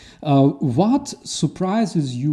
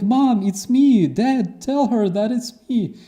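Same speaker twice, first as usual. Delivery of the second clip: desperate son; 10 semitones higher, 3 dB louder than usual. Retold or imitated. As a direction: cry out to mom and dad to believe you. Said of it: "He's like".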